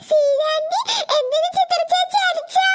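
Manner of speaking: put-on slow, high, tearful child's voice; small child sobbing to her mother after the hitting; crying child. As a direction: ask through tears like a frightened little girl.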